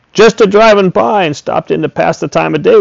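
called out high and loud across the field; a farmer shouting across the orchard; neighbourly shout. A narrator's reading. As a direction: hail him from the cart. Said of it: "Mister Swale shouted".

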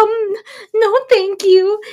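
put-on high, quavering little old lady. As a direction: read it like a sweet old lady. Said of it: "And she's like".

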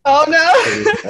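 laughing. Real, not acted. Laughing reaction to a joke.

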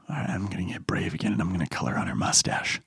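put-on low, hushed, hurried kid's plotting; conspiratorial whisper. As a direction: whisper it like a secret dare. Kid secretly steeling himself.

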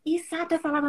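ordinary delivery

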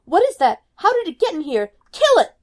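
high, loud panicked shriek. A narrator's reading. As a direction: scream in horror at the lizard. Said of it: "Mother screams".

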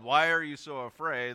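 loud, forceful yelled question; Jesus yelling at the disciples. Shown as a demonstration. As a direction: raise the voice sharply, demanding an answer. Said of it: "if Jesus yelled".

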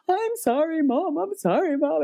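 high, pleading teenage son, imitated; retold upset apology. Upset son apologizing to his mom, pleading. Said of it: "he's upset, he's crying".

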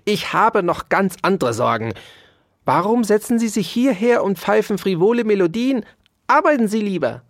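high, scolding tirade; irritated shouting. A narrator's reading. Dauerspeck tells the whistling mouse to work, scolding. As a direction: scold with pitch pushed up. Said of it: "schrie Ambrosius Dauerspeck".

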